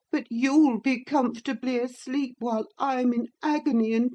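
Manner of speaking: put-on high, plaintive boy's voice; self-pitying sick boy. Linton blames Cathy for sleepless nights, high self-pitying whine.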